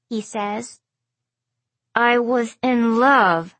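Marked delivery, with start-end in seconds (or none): 1.9-3.6 slow, tight, gritted teeth; through gritted teeth. A narrator's reading. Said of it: "she says through gritted teeth".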